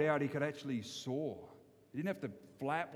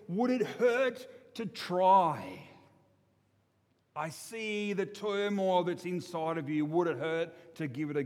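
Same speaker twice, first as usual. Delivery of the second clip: coaxing owl; 3 semitones higher, 4 dB louder, slower than usual. Acted. Wise owl coaxing gently.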